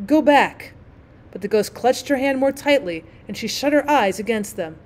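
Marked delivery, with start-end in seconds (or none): 0.1-0.7 higher, louder warning call; urgent raised cry. A narrator's reading. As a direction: call it out sharply. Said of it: "they cried".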